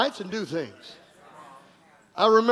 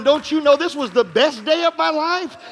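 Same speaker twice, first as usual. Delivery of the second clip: louder, higher, exultant declaration; joyful testimony; 5 semitones higher, 6 dB louder than usual. Retold or imitated. Pastor telling the officer, loud and exultant. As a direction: proclaim the best day. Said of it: "I told him".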